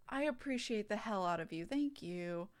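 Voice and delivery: ordinary talk, nothing marked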